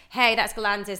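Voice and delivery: hyped club-radio presenter, riding the bed; DJ talk-over. Shown as a demonstration. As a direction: sell the track name over the beat.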